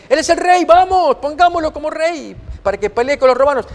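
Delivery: high, eager rallying cry; excited crowd. Retold or imitated. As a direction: rouse the crowd to crown him king.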